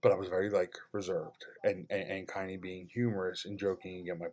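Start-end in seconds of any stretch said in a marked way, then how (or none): none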